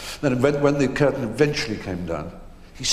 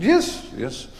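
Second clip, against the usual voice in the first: Edith's reply; 11 semitones higher than usual. Retold or imitated. Edith voiced in anecdote.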